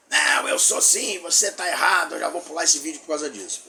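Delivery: protesto exaltado, mais alto na voz e mais agudo no começo; espectador indignado. Acted. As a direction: imite um espectador contrariado rebatendo aos gritos. Imita espectador indignado que discorda. (espectador indignado, em protesto encenado)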